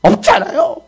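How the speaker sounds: high-pitched whiny grumbling; complaining. Acted. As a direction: whine in protest like grumbling followers. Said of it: "뭐만 하려고 하면 불평하고".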